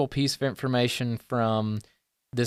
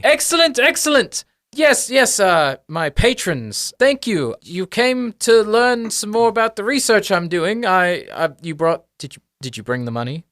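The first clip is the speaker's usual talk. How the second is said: high, delighted, fussy academic; excitable little professor, trailing into a question; gnome professor; 8 semitones higher, 4 dB louder than usual. Acted. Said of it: "And he's like".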